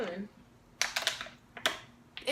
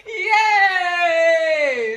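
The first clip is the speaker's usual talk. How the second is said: cheer; 4 semitones higher, 8 dB louder than usual. Real, not acted. Cheers at the guess.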